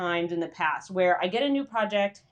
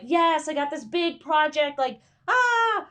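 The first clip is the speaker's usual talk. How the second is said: thrilled squeal; 7 semitones higher, slower than usual. Retold or imitated. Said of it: "instead of feeling excited that you're like".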